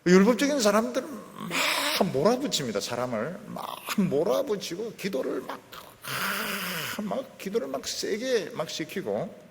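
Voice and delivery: lightly mocking zealot; mock legalist. (율법적인 사람을 흉내내듯 높게)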